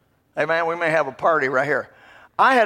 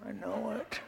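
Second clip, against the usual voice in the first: murmured admission; 3 semitones higher, 13 dB quieter, slower than usual. Retold or imitated. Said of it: "I said".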